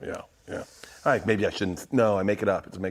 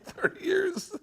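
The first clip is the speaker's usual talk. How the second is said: very high strained squeal; mock outraged whine; imitated irate driver; 4 dB quieter, slower than usual. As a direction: squeeze out the indignation up high. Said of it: "That's what he said to me".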